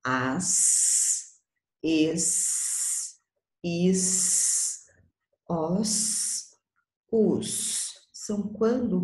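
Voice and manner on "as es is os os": each syllable pulled apart and said slowly: "As", "es", "is", "os"; sounding out the vowel-plus-S syllables; sound demo. Shown as a demonstration. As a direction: sound out every vowel plus S clearly for children. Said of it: "Então, vai ficar assim, ó".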